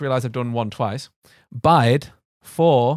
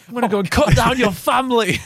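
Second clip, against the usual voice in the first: high-pitched, over-the-top threat; mock threat; 9 semitones higher, 4 dB louder than usual. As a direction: threaten the furniture in pretend fury. Acted threat to wooden table.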